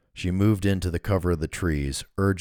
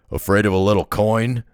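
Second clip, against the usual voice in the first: loud, low, mocking thug; low rough voice; 4 semitones higher, 7 dB louder than usual. A narrator's reading. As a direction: jeer it roughly. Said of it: "the low voice".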